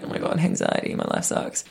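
soft whispered self-pity; whispered moan. Retold or imitated. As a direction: breathe it out like a miserable whisper. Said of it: "and I was like".

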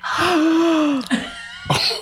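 high, sharp mock gasp of shock; a dramatic gasp drawn in; imitated class gasp. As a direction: act out the whole class gasping. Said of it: "the whole class, like, gasp".

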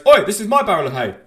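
indignant stranger. Acted. Man in the hay objecting to an intruder, indignant shout. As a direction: shout like an aggrieved hay-barrel owner. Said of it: "the guy just goes".

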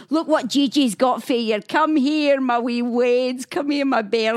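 put-on light Scottish accent of a great-grandmother calling the kids; Scottish granny accent. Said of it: "A Scottish accent".

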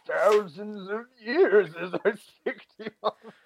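high, aged, creaky exec voice; a withered old exec on the phone; Michael Eisner voice. Pretend. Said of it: "I, Michael Eisner".

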